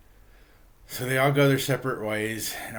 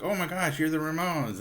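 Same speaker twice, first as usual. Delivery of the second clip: higher-pitched mock gushing; starstruck fan, retold; 7 semitones higher than usual. Archies meet the Ramones in front of Max's, mock starstruck. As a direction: imitate fans amazed to meet their idols. Band meets its idols, retold.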